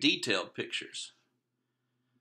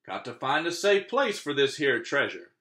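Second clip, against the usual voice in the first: high, loud pirate announcement; pirate announcing; 5 semitones higher, 4 dB louder than usual. Acted. Braid Beard announcing departure, high and loud. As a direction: announce it like a captain. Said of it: "he announced".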